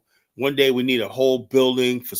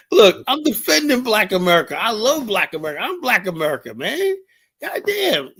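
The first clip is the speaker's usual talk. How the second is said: excited; 9 semitones higher, 4 dB louder than usual. Real, not acted. Heated self-defense on air.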